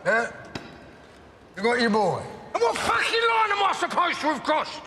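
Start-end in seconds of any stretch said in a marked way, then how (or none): 2.5-4.8 angry shout. Acted. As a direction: throw the question in his face. Heated quarrel outburst.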